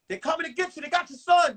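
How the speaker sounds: lightly hammed-up alarm; panicked girlfriend. Girlfriend warning the mother on the phone, mock panicked. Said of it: "Tyene is telling her".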